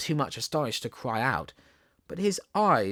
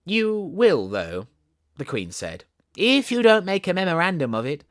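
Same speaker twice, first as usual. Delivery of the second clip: Queen's dry retort; 4 semitones higher, 6 dB louder than usual. Acted.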